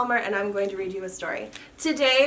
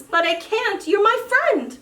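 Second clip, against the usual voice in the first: much higher, heartfelt exclamation; heartfelt cry; 9 semitones higher, 3 dB louder than usual. Acted. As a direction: blurt it out together. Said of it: "they both said at once".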